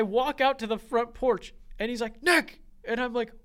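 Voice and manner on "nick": loud high call of his son's name; retold shout. Father calls his son out to the porch, shouting. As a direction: yell the name to get him outside. Dad calling son out front.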